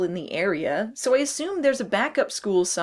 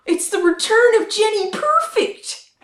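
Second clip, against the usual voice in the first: high loud hyped announcement; excited announcer; 10 semitones higher, 6 dB louder than usual. Acted. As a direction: announce it like a big return. Announcing a character's return.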